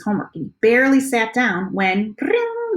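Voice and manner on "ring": high sustained ringing tone on ring; phone ring imitation. A narrator's reading. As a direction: sing ring like a phone bell.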